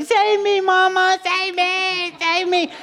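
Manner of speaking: high, wailing child's plea, hammed up; imitating a toddler's high wail; mock scream crying. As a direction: play a bath-time toddler crying for rescue. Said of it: "scream crying".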